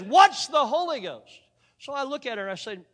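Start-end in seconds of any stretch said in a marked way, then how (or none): 0.0-1.4 higher, louder, slow, hitting "What's"; incredulous repeat. Retold or imitated. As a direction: repeat it in disbelief, stretching it out. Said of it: "But she says".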